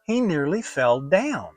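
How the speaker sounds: ordinary delivery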